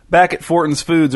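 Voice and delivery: usual speaking voice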